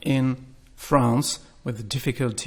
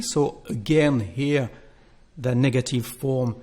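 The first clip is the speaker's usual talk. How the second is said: ordinary delivery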